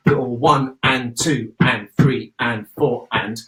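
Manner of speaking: slow, spread count with ands; slow subdivided count. Shown as a demonstration. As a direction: count slowly, stretching the ands. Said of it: "stepping on the beat".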